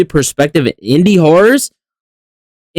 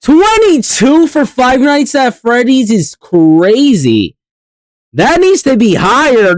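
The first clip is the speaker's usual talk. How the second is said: outraged disbelief; 7 semitones higher, much slower than usual. Real, not acted. Outraged at the list ranking.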